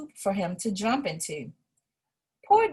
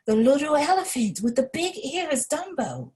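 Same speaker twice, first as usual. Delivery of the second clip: sleepy ringmaster; 6 semitones higher, faster than usual. A narrator's reading.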